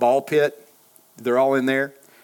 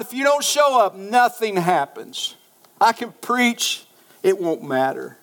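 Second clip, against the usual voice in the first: raised pitch, pleading with God; impassioned prayer; 5 semitones higher than usual. Retold or imitated. Preacher telling God nothing happens without Him, impassioned pleading. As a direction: plead from the pulpit, stretching key words.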